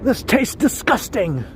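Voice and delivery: high, drawn-out British chef outrage; mock disgust in a put-on British voice; angry Gordon Ramsay. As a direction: play an exasperated celebrity chef, slowly. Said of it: "an angry Gordon Ramsay voice".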